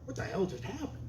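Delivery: bewildered couple. Retold or imitated. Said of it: "and they go".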